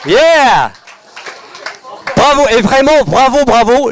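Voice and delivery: loud, high, pumped-up call over music; rally the room like an emcee; hyped host shouting instructions over the music; energetic workshop host. Acted. Animates acting exercise over music.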